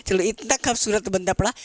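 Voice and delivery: ordinary delivery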